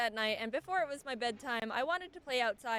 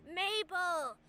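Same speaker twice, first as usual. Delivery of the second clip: yelling lady; 7 semitones higher, much slower than usual. Retold or imitated. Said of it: "some lady was yelling".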